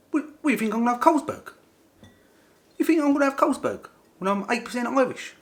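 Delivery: very high, loud comic rant; mock outrage. New Irishman refuses the drink offered, hammed-up indignant shout. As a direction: shout back as if gravely insulted.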